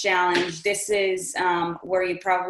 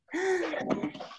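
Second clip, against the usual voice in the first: sharp excited gasp; 8 semitones higher, 7 dB quieter than usual. Real, not acted. Realizes who her friend is.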